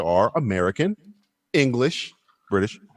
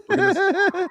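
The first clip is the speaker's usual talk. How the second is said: breaks into a high laugh mid-phrase; slower than usual. Real, not acted. Host cracking up while opening the show, laughing. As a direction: laugh through the words.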